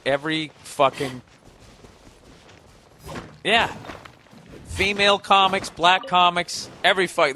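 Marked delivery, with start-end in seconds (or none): none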